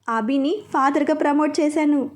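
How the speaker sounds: plain reading voice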